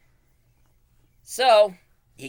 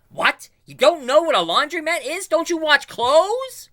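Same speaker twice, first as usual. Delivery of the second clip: much higher, loud disbelieving cartoon hero; incredulous Buzz; 10 dB louder than usual. Buzz to the bartender, shrill high disbelief. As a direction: squeak it out in shock. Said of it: "talking to the bartender saying".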